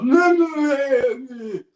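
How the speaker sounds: drawn-out high, loud garbled shouting; loud, slurred heckler's holler; incoherent heckle. Acted. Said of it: "the incoherent heckle".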